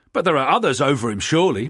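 fast, loud, high protest. Acted. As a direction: object quickly and hotly. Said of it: "protested another".

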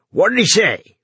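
sharp, urgent demand. Acted. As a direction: demand an answer now. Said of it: "Runciter demanded".

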